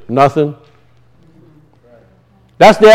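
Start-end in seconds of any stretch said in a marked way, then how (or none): 0.1-0.6 low, soft deadpan reply; a hint of mock indifference; mock lazy answer. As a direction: shrug the word out.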